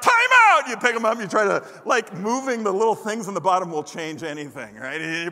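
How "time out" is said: much higher, louder playful yell; shouted time out. Retold or imitated. Kid halts his toy football game, playful loud yell. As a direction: call time out like a frantic kid. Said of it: "So you're like".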